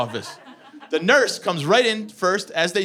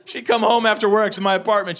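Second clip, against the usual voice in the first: ordinary talk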